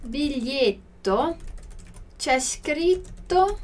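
very slow, each word apart; slow teacher demo of the question; slow modelled question. Shown as a demonstration. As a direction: read the question out slowly for learners. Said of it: "Ele pergunta".

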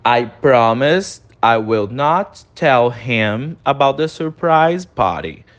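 very slow, each word of "I promise I will not tell him" spaced; slow repeat-after-me demo; slow pronunciation model. Shown as a demonstration. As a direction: say it slowly for students to repeat. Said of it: "repete a pronúncia comigo".

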